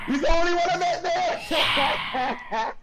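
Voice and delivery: high, laughing yelp of disbelief; shocked outburst. Retold or imitated. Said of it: "and I was like".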